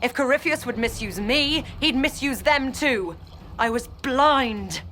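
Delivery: high, impassioned outcry of betrayal; betrayed outburst. Acted. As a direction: let the anger at being deceived ring out. Betrayed follower learns the truth.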